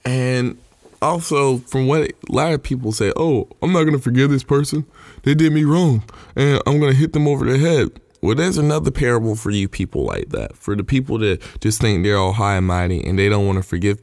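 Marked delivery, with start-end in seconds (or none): 3.2-7.9 higher-pitched imitation of defiance; defiant refusal. Preacher voicing people who won't forgive, mock defiant. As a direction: lightly mimic a stubborn grudge-holder, pitched up.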